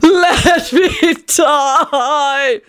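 very slow, high sobbing wail; anguished wail. A narrator's reading. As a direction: draw each word into a melodramatic wail. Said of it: "Tony wailed in anguish".

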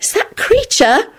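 slow, high bewildered question; baffled, drawn-out query. A narrator's reading. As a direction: ask it in high-pitched disbelief. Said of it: "said in a bewildered voice".

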